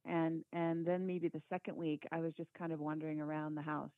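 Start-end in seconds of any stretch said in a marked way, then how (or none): none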